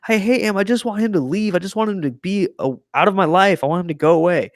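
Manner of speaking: high-pitched mock whine of a crying boy; imitated crying tantrum. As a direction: act out an upset kid wailing, half in earnest. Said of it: "and, like, crying".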